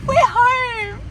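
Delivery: high, delighted squealing with laughter; thrilled squeal. Real, not acted. To husband David on arriving at their new home, high delighted squeals. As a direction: burst out with real joy at finally being home.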